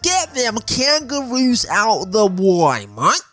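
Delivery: slow, hammed-up Australian accent with a rising mate; terrible Australian accent. Showing off the modified ute to viewers, in a put-on bad Australian accent. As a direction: ham up a bad Australian bloke voice, slowly. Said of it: "I'm so sorry about that accent".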